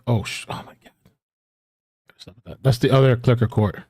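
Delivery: ordinary delivery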